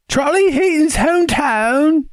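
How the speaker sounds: very high, silly fake British voice; exaggerated British accent. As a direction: put on a squeaky comic British accent. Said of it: "love doing British accents".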